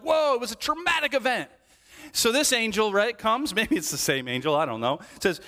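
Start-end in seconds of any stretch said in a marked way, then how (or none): 0.0-1.5 high, startled whoa; mock startled shepherds. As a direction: play the shepherds jumping in fright. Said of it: "Shepherds are like".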